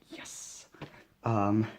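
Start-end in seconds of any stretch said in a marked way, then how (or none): 0.1-0.7 retold whispered "yes" of triumph; hushed cheer. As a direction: celebrate under your breath, very softly. Retold delight at pulling wanted card.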